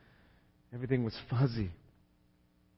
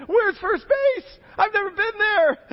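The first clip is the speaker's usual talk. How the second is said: put-on bewildered kid; 5 dB louder than usual. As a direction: ask it like a confused child. Boy voiced asking dad.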